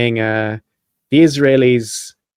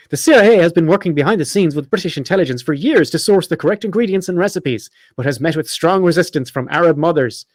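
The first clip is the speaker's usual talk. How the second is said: higher-pitched parody newsreader; mock news read; 4 semitones higher than usual. Host reads his satirical hummus article, mock news read. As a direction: read it like an earnest news bulletin.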